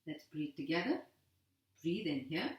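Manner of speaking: brisk, steady breathing-class count; guided breathing cues. Acted. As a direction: keep the group breathing on each cue. Leads a timed breathing drill.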